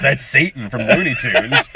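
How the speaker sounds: normal talking voice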